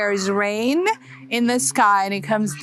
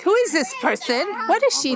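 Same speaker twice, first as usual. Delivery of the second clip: lightly voiced curious children, somewhat higher; curious kids; 8 semitones higher than usual. Kids watching the speaker film on a rock, in a mildly higher curious voice. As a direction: wonder aloud like a nosy little kid.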